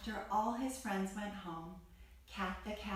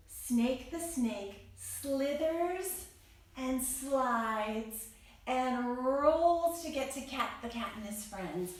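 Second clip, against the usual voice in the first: slow slithering narration; slower than usual. Acted. Acting out slithering snake.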